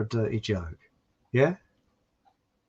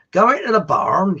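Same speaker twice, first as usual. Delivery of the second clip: farm labourer; 7 semitones higher, 6 dB louder than usual. Acted. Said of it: "the other farm labourers say".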